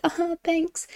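high-pitched, put-on sarcastic thanks; mock thanks. To someone who took four days to reply, sarcastic. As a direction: throw out a high, incredulous retort. Acting out reply to slow texter.

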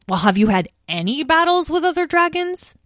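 put-on hopeful young dragon question. A narrator's reading. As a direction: ask it like a kid hoping for a fight story. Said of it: "asked Lyle hopefully".

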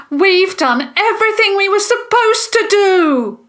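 high, loud, sustained yell; impassioned high-pitched shouting; urgent shout. A narrator's reading. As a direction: shout it out like a declaration. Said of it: "Jasmine shouted as he finished".

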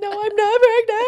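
high, loud offended squeal; hammed-up indignant denial to the asker; mock outraged denial. Woman asked if pregnant, loud high mock outrage.

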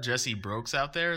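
normal talking voice

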